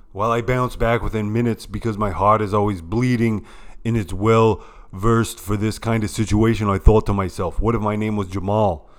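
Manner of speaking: loud, hammed-up ranting old senator; Bernie Sanders impression. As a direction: rant like a fired-up old senator at a podium. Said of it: "Here to comment is Bernie Sanders".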